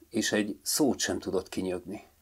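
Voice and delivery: usual speaking voice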